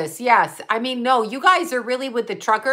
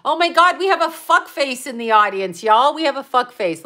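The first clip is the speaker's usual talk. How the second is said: indignant shout-out; outraged callout; 3 semitones higher, 3 dB louder than usual. Real, not acted. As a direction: call out the heckler to the crowd. Calling out a heckler.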